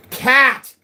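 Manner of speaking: high, loud gleeful cry of "Cat!"; cheering the one-word answer to chat; triumphant shout. Acted. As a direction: shout the answer for the crowd to join. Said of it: "Everybody, say it with me now".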